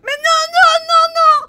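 anguished crying shout, high and loud; crying scream. Acted. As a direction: scream out in despair. Sees friend shoot himself.

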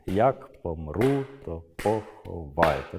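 slow, each stressed syllable hit hard; rhythmic stressed recitation. Shown as a demonstration. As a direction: beat out the stresses like clapping. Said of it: "відбивати ритм долонями".

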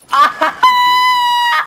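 very high squealing laugh; triumphant high cackle; shrieking laugh. Real, not acted. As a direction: let out a piercing gleeful shriek.

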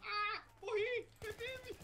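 high, loud cry of dismay; dismayed shout. Real, not acted. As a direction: yelp at dying. Players dying in the game.

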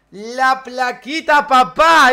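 drawn out, rising to a loud proud shout; proud slow boast. Acted. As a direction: show off the plaque, loud and slow. Shows off his award plaque. (presumiendo la placa, lento y fuerte)

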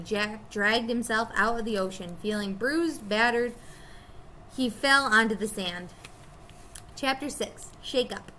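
ordinary delivery

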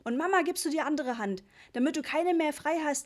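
irritated, bossy father; cross parental order. A narrator's reading. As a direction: snap instructions at a child. Angry Papa orders the child.

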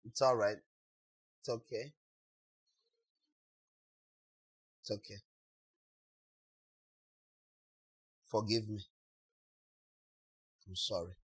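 soft, low, hushed reassurance; softly comforting someone in a hug; gentle soothing. Retold or imitated. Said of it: "For real, a hug".

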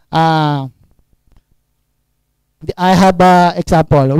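ordinary talk

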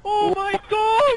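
high, slow, drawn-out scream; terrified scream. Real, not acted. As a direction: scream it in terror. Screaming after the jump scare.

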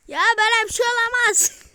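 loud, high protest of disbelief; can't believe what just happened; disbelieving outcry. Real, not acted. Oyunda şaşkın itiraz. (inanamayan yüksek sesli itiraz)

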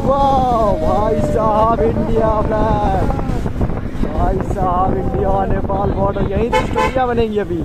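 loud, high thrilled yelling; excited shouting. Real, not acted. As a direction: shout it out, thrilled to see the border flag. Reaching the India Nepal border. (Border देखकर जोश में ऊँची आवाज़ में चिल्लाना)

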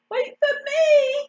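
high, loud plea to be waited for; squeaky little duckling calls after the others; calling out. A narrator's reading. Ugly duckling to ducklings ahead, calling out. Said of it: "called the ugly duckling".

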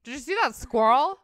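loud American squirrel said as one syllable; mock American, loud; American accent. She mimics Americans, loud American accent. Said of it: "Americans make squirrel".

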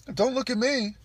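high, loud mock protest; reluctant bigger guy. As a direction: refuse loudly, passing the buck. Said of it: "a bigger guy, it's like".

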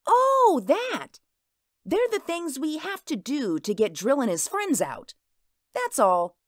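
lightly voiced little girl, brushing it off; a child waving off her mother's question; young girl Mink.

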